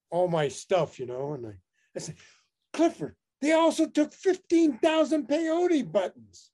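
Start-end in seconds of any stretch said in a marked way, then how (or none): 2.7-6.5 indignant complaint. Retold or imitated. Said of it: "join in on my anger".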